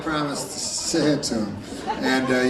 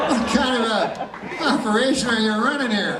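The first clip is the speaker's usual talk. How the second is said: much higher, baffled dog voice; indignant dog; 8 semitones higher than usual. Retold or imitated. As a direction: play the dog, high and put out. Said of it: "And then the dog goes".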